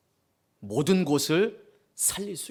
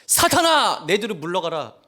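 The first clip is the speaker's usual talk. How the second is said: stern rebuke; 5 dB louder than usual. Pretend. Jesus rebuking the pastor who holds him back, loud shout. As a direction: cast Satan back with a sudden cry. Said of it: "주님이 뭐라 하신지 아세요?".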